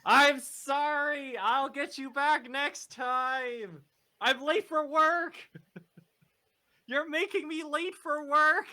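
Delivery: high, loud, drawn-out apology; yelling back. Acted. Late worker running from the store, yelling back to John. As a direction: shout it back over your shoulder as you run.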